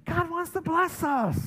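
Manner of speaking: high, slow, loud preaching; preaching emphasis. Real, not acted. Pastor proclaiming to congregation, high slow preaching. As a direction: proclaim it slowly from the pulpit. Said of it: "preaching emphasis".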